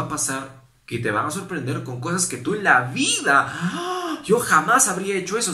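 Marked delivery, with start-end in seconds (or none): 3.5-5.5 higher and louder, scandalized; high, shocked protest; mock shock. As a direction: play the self-righteous gasp. Mimicking shocked self-righteousness.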